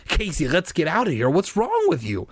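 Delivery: cross, rising protest; angry cry. A narrator's reading. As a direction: cry it angrily at a brother. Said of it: "Margaret cried angrily".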